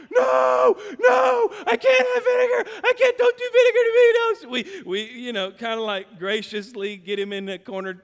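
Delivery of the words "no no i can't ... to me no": put-on high, loud childish yelling, laughing at the end; a little boy shrieking no at the vinegar; panicked child protest. Son protests against the vinegar.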